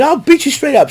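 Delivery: high, loud mocking parody of the boast; mocking Logan Paul. Host mocks Logan Paul's boast in a high, loud parody. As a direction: sneer it back in a silly high voice. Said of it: "And then he said".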